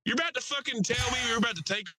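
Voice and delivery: high-pitched outraged Southern heckler; mock heckler announcement. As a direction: heckle the pilot for the whole cabin. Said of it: "announces into the plane".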